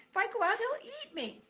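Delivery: high, begging mouse voice; pleading. Acted. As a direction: plead with the grumpy porcupine. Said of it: "mouse pleads".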